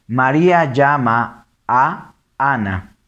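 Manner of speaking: slow, words held apart; deliberate word-by-word reading; read separately. Shown as a demonstration. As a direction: pause round the "a" before "Ana". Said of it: "Acá son tres que se unen".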